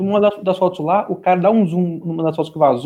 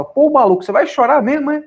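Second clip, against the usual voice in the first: high, louder taunting quote; mocking; 8 semitones higher, 3 dB louder than usual. Leftist taunting a whiner in a retold debate, mocking. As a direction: tease him as a crybaby, pitched up.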